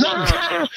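high-pitched, softened mock yell; retold yell. Buddy leans over to scare it off, high mock yell. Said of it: "starts yelling".

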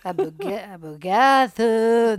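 lampoon a posturing guy, dragging each syllable; mock pretentious drawl. Mocking catchphrase again.

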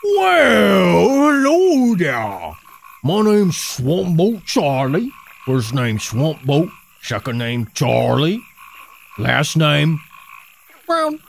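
hammed-up Cajun swamp guide, Cajun accent. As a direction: play a swamp hunter in a thick Cajun accent. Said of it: "Cajun fellas".

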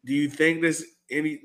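normal talking voice

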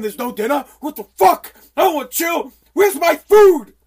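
mock-furious yelling, high and loud; pissed off. Acting out how pissed he is about dinner, mock rage. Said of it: "this, how pissed I am".

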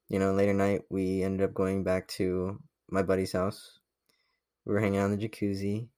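normal talking voice

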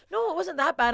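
mock polite agent reassurance; imitating agent. Agent voiced in a retold story.

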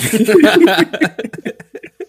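real burst of high laughter; loud laugh. Real, not acted. Friends recalling a gaming rage, laughing loudly. Laughing after retelling the joke.

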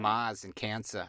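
put-on slow Boston accent, "Mars in Cancer" with the r's dropped, mahz in cansa; a light Boston accent, no r on "Mars" or "Cancer". Host jokes to co-host, says it in a slow Boston accent. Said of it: "I wanted to say Mars in Cancer in a Boston accent".

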